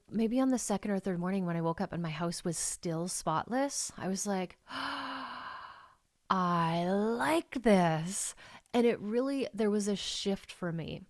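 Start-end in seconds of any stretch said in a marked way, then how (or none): 4.6-5.7 high, soft acted gasp; long indrawn gasp of mock wonder; delighted gasp. Retold delight at still-spotless house. 6.3-8.4 very slow, loud, relishing realisation; savouring delight. Retold or imitated. Retold delight at still-spotless house.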